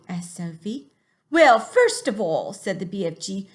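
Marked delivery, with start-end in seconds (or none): 1.3-2.5 slow, loud, higher funny English giant voice; a big friendly giant in a funny English accent, drawn out. Acted. Teacher voicing the giant for her class, funny accent. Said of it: "give him a funny accent too".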